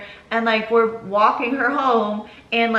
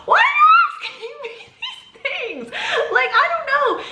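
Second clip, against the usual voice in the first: very high, laughing squeal of disbelief; retold exasperation, pitched way up; exasperated outburst. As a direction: squeal it out, fed up and half laughing. Exasperated by Rick's string of questions.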